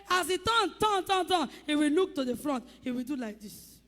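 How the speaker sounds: normal talking voice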